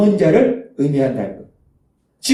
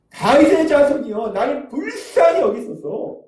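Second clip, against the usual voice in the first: acted high plea, stretching out the words for mercy; crying out louder; 5 semitones higher than usual. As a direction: implore with a long, high call, as a beggar who won't be silenced. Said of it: "더욱 크게 소리질러".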